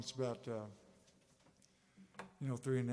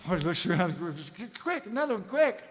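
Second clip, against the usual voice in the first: frantic bar customer; 8 semitones higher, 9 dB louder than usual. Acted. Panicked drunk rushing into bar.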